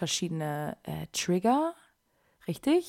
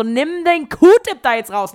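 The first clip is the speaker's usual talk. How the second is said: very loud, high, drawn-out scolding; scolding mother; 7 semitones higher, 15 dB louder, slower than usual. Retold or imitated. Mother ordering her child to stop, mock scolding yell. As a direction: imitate an exasperated mum yelling an order.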